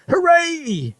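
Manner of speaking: high, loud cheer; husband cheering that grandma is gone; gleeful cheer. Acted. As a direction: whoop with joy. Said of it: "shouted Mister Cranky".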